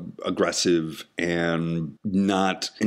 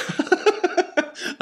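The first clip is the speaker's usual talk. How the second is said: high laugh. Real, not acted. Laughs at own voices.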